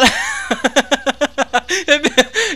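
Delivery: long high amused laugh. Real, not acted. Player laughing at the beaten-up fighter, high laugh. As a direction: laugh at the battered hero.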